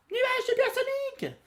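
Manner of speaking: very high, ringing cartoon call; calling out loud. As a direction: shout for the flying cloud. Goku calls cloud, Bulma scolds.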